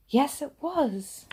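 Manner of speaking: delighted yes. A narrator's reading. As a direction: burst out in delight as the train comes. Train arriving, delight.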